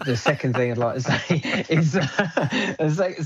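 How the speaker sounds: real laugh breaking into the words; giggling mid-sentence; laughing while talking. Real, not acted. Cracks up after cheering Egypt.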